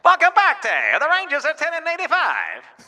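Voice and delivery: high, loud vintage radio baseball call; excited 1920s radio baseball announcer; old baseball announcer. Acted. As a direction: welcome the listeners back like an old-time announcer. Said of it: "Texas Rangers baseballers".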